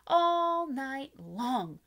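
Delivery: ordinary delivery, nothing marked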